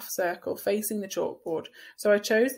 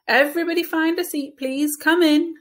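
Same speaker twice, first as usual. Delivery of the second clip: loud, higher classroom call; teacher directing; 8 semitones higher, 9 dB louder than usual. A narrator's reading. Teacher ushers late kids to seats, loud and bright-voiced. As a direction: wave the kids in over the noise.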